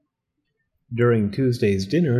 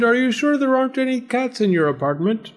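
high, prying storybook question; nosy landlord, pitched way up; suspicious landlord; 12 semitones higher than usual. Acted. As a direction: probe for hidden cats.

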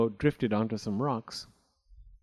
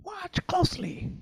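very high, small crow voice; a crow's confiding stage whisper, pitched way up. A narrator's reading. The crow confides in his ear in a high stage whisper. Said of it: "the crow whispered in his ear".

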